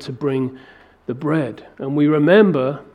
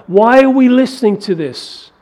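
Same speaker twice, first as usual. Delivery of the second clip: high, loud indignant peak; David's loudest call against the giant's taunts; confident outcry; 8 semitones higher, 7 dB louder than usual. Acted. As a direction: hurl it as the final challenge. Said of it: "he's speaking with real confidence and real faith".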